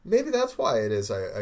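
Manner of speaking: normal talking voice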